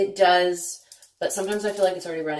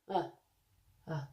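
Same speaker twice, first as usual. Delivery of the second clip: ordinary delivery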